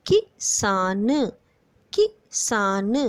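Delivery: "किसान" slowly, a quick light कि, then a long सान; light short इ. Shown as a demonstration. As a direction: sound out "किसान" in pieces with the first vowel short. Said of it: "हल्के से बोला गया". ("किसान" में कि हल्के से, सान खींचकर)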